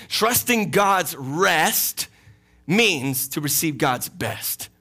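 each word drawn out and weighed; "Trusting God's rest" said slowly, word by word; slow emphatic. Shown as a demonstration. Preacher spelling out his motto slowly for the church. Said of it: "Let me say it like this".

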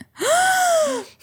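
imitated shocked gasp, high; sharp gasp. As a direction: gasp like something terrible happened. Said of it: "she went".

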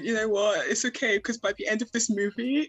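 airy, high, dreamy voice; dreamy movie heroine. Retold or imitated. Quoting her romanticizing self.

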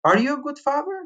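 retold angry challenge to the lawyer. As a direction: throw the question back at him in anger. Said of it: "really angry and, and, like, literally pissed".

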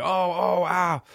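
high mock yelps of pain, hammed-up. Injured Kiki challenger, mock yelping in pain. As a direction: squeal it like a hurt dancer. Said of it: "and they're just like".

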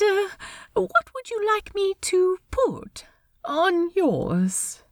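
high, lilting, polite voice; awkward host in a high register; Steed, politely awkward. Acted. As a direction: pitch it high, hesitate politely. Steed explains the name tag.

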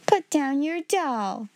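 a touch of stern authority, drawn out; stern headmistress. A narrator's reading. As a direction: give a slow, firm command as a strict headmistress. Stern headmistress orders girl.

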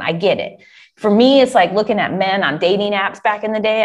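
ordinary talk, nothing marked

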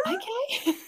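high, soft, puzzled; bemused; bemused okay. Real, not acted. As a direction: shrug it off bemused. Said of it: "bemused reaction".

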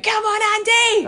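high, loud yell from the crowd; screamed cheer. Retold or imitated. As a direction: scream it out to rally a player. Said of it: "to scream out".

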